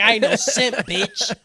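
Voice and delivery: loud swagger cracking into laughter; mock macho boast. Goblin Slayer denying he simps, loud mock bravado. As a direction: boast it hard, half laughing. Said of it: "He's like".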